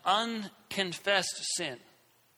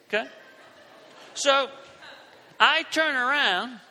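usual speaking voice

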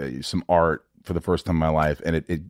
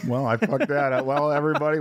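ordinary talk

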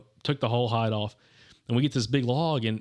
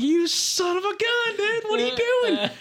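very high, drawn-out mock outrage; retold losing it; 3 dB louder, slower than usual. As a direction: squeal in disbelief, reenacting your outburst. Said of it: "I about lost it".

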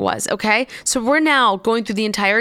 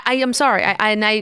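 usual speaking voice

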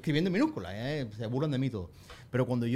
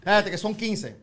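raised, higher mock chatter; loud supermarket shopper; 5 semitones higher, 5 dB louder than usual. Shopper sorting out a purchase, loud and oblivious. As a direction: call it across the aisles. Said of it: "escuchas a la señora que está en la fila uno".

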